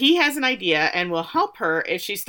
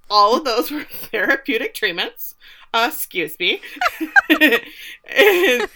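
laughing; 5 semitones higher, 5 dB louder than usual. Retold or imitated. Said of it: "she says".

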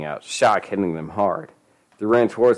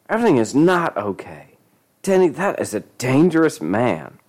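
higher, emphatic scolding warning; stern contradiction; 7 semitones higher than usual. A narrator's reading. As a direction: contradict firmly with swooping emphasis. Claudette contradicts, warning Denny.

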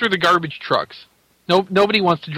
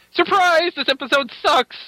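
surprise party shout; 10 semitones higher than usual. Pretend. Mock surprise party yell.

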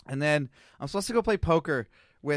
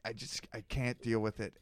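retold quiet plea; 5 semitones lower, 8 dB quieter than usual. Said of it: "And I'm like".

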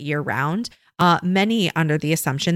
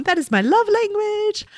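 much higher teasing aside; playful joke. Acted. Host joking to listeners that reviews are her love language, playful and higher. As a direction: toss it off high and cheeky.

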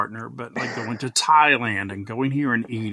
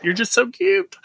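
much higher, squealing gush; 10 semitones higher than usual. Retold or imitated. Host squealing over the TikToker, much higher. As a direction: squeal over how cute he is. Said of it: "I'm just like".

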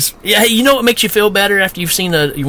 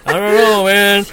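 higher mock camp owner rising to a squeaky yelp; mock Mel; 4 semitones higher than usual. Camp owner Mel pleading with the cop, climbing to a high shout. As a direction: whine it higher and higher till it cracks on accident. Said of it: "Mel's like".

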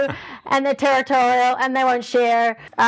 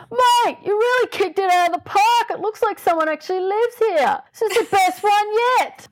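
deliberately bad Australian bloke accent, pitched much higher; bad Aussie bloke accent; 9 semitones higher than usual. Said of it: "really bad Australian Aussie bloke accent".

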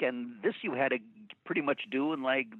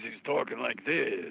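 nineties Pacino voice. Shown as a demonstration. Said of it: "And then in the nineties".

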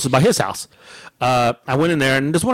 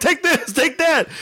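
high-pitched defiant jabs; retold defiance; 13 semitones higher, slower than usual. Customer walking out on workers, retold defiance. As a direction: fire off both bits up high. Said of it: "I'm like".

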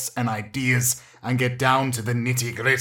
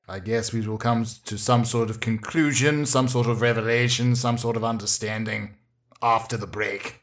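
host tease; 5 semitones lower, 5 dB quieter than usual. Acted. Host teases upcoming break.

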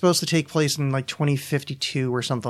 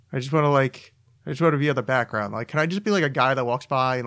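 ordinary delivery

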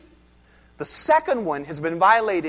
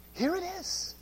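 much softer repeat; quiet echo; 9 semitones higher, 11 dB quieter than usual. Shown as a demonstration. Preacher repeating his point to the congregation, softer echo. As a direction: let it drop into a hushed aside.

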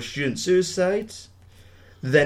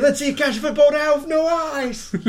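high, mocking taunt; 13 semitones higher, 3 dB louder than usual. [name] taunting the blinded player, high mocking voice. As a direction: sneer it up high like a bully.

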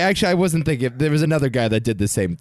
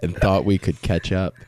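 sexy voice; 4 semitones lower than usual. Pretend. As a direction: read it like a seductive come-on. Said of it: "start reading in your sexy voice".

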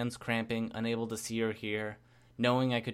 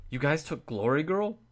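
rising, unbelieving question; can't believe what you're hearing; incredulous; 5 semitones higher, 4 dB louder than usual. A narrator's reading.

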